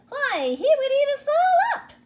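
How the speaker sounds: a tiny queen terrified of being eaten; frightened mouse queen. Acted. Mouse queen fears the lion.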